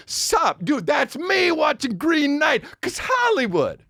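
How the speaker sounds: a lazy shouted sup, like Cage ad-libbing; Nicolas Cage impression. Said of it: "Nicolas Cage is just like".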